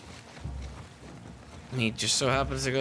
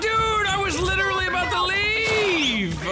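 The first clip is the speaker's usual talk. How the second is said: loud high frustrated yell; frustrated shout; 4 dB louder than usual. Real, not acted. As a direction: yell it out in disbelief. Killed just before leaving.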